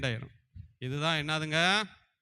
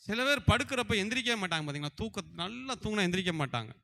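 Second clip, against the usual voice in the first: ordinary talk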